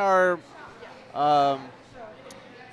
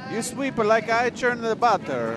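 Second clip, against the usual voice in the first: put-on slow, higher-pitched Italian accent; old Italian chef; 4 semitones higher than usual. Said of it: "is the old Italian chef".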